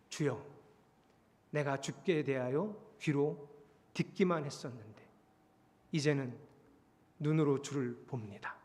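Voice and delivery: hushed, reverent admission; humble confession. Acted. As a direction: confess it quietly, with long pauses. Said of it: "그냥 말한 게 아니고요".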